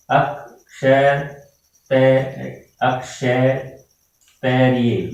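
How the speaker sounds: Akshay stretched syllable by syllable; Akshay, तय, spelt out slowly; slow spelling out. Shown as a demonstration. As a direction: drag it out like a child writing it down. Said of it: "बच्चे फिर ये परीक्षा में यूं लिख के".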